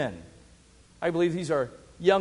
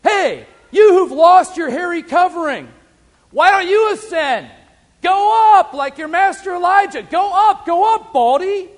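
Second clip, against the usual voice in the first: much higher, louder sneering taunt; young men taunting the prophet to ascend; mocking jeer; 11 semitones higher, 11 dB louder than usual. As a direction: jeer at him like scornful young priests. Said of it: "they're saying this to him".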